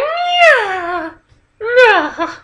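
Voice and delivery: very high mock wailing whimper; wife's whimper cry. Wife panics as husband walks away, high mock wail. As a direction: squeal it like a scared woman. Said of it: "That voice".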